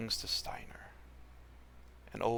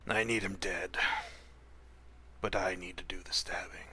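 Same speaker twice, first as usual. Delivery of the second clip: rasping whisper; 3 semitones lower than usual. Acted. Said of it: "wet, raspy whisper".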